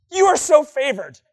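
loud, high, bursting with good news; super excited. Acted. Gabriel to Mary with long-awaited news, super excited. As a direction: blurt it out in overjoyed excitement. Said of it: "He's probably super excited".

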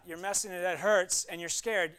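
usual speaking voice

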